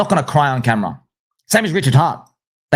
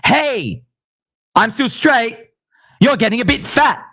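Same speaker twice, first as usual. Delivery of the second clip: lightly voiced high mocking quote; mock Jay Waller; 10 semitones higher than usual. Mimicking Jay Waller telling a friend he's fat, mockingly. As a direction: parody the line in a higher voice.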